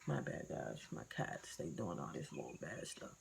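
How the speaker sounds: very quiet, half-whispered apology; hushed aside. Real, not acted. Creator apologizing to viewers for noisy cats, hushed aside. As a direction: drop right down to a near whisper.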